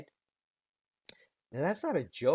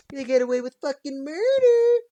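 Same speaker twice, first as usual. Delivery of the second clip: high, loud indignant yell; shrieking that they get away with it; outraged shout; 13 semitones higher, 12 dB louder than usual. Real, not acted. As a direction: bellow the outrage. Outraged repeat.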